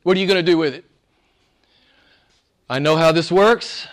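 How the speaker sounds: ordinary talk, nothing marked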